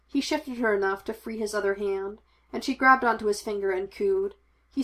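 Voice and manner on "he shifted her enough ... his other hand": usual speaking voice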